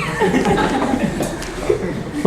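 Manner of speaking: breathy unvoiced laugh, falling away; a scoffing laugh at the excuse; airy laugh. Real, not acted. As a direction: laugh it off in disbelief. Laughs at student's prayer excuse.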